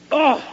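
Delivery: sudden high frustrated "Oh!"; young man throwing up his hands; exasperated cry. Retold or imitated. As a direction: blurt it out, fed up. Young man's frustration acted.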